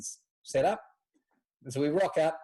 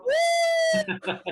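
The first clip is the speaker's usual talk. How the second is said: cheer; 5 dB louder than usual. Retold or imitated. Room cheering the drinker.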